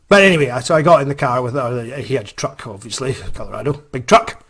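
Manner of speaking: ordinary talk, nothing marked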